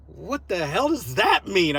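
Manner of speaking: slow, drawn-out disbelief; retold incredulity. Vlogger answers the suspenders accusation, incredulous. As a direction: replay your total bafflement, stretching the words.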